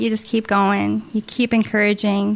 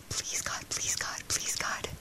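breathless whispered pleading; barely voiced please God; whispered prayer; 16 dB quieter, slower than usual. Desperate prayer while waiting.

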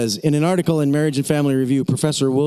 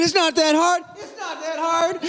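triumphant hollering; 12 semitones higher than usual. Retold or imitated. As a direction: cheer it out, then echo it back. Final call and echo at bottom.

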